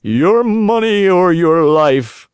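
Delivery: a bandit's slow threat; menacing robber voice. A narrator's reading. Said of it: "two horrible, deep voices".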